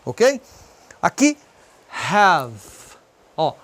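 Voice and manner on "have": "have" ending on a bare v; silent final E. Shown as a demonstration. Teacher modelling the example word, silent E in "have". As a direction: close "have" on the v, no ee. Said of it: "não se pronuncia no final".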